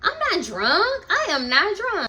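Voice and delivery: put-on high, slow insistence that she's not drunk; mock drunk denial. Friend [name] to the group, insisting in a high, slow drunk voice. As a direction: play the tipsy friend swearing she's sober.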